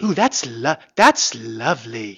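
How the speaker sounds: slow, drawn-out mock-British delight; calming British accent. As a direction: echo a British woman's accent, slowly. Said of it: "it's very calming".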